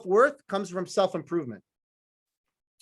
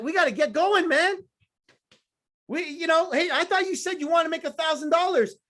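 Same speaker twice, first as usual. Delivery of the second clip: pushy manager calling out; 8 semitones higher than usual. Retold or imitated. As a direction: call each name like a manager cracking the whip. Calling out team members by name.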